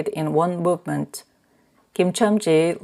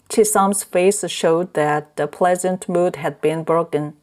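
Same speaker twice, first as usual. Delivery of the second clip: ordinary delivery, nothing marked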